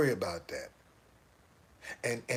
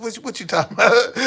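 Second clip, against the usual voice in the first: louder, higher feigned innocence; mock innocent; 8 semitones higher, 13 dB louder than usual. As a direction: play dumb, loud and high. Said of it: "I said".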